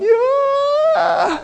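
a high, whooping yell of a partier; a mock reveller's yell, high and whooping; wild whoop. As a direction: shout it like someone lost in a party. Reveller far gone in sin.

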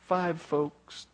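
ordinary talk

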